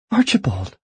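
high startled squeak of a name. Acted. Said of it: "she squeaked".

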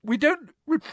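high, stammering tearful voice; put-on weepy girl; sniffling. A narrator's reading. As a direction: sniff and falter mid-word. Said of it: "sniffing loudly".

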